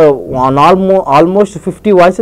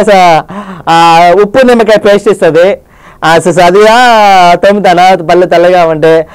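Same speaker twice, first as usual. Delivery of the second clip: Rajasekhar impression. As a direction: drag it out with lots of "ఆ, so". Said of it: "Rajasekhar గారి యొక్క voice". (Rajasekhar గారి audio function మాట తీరులో)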